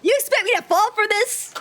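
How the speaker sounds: high, incredulous challenge; scornful disbelief. Acted. Character rejects the trick.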